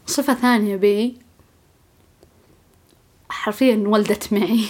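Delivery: ordinary talk, nothing marked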